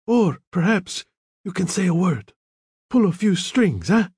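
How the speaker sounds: lightly voiced sly appeal; half-mocking plea. A narrator's reading. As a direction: coax a favour, not really serious. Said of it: "as if he were mocking the Don".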